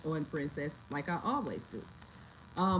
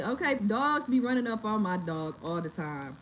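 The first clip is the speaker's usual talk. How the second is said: usual speaking voice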